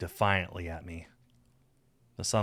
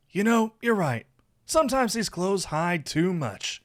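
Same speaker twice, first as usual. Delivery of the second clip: high, louder teasing lilt; playful, flirting Saphir; 11 semitones higher, 5 dB louder than usual. A narrator's reading. Saphir agreeing his clothes hide too much, flirtatious. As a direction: tease your lover as you undress.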